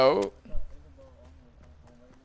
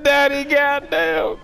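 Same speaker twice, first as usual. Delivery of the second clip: high, drawn-out mock holler; slow, high-pitched imitation; mimicking the clip's outburst; 11 semitones higher, 4 dB louder, slower than usual. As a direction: stretch it out high, imitating him. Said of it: "He said".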